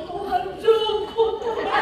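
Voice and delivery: mock sobbing prayer with a laugh; mock weeping prayer. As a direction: pretend to cry out to God over him. Mock tearful prayer for husband.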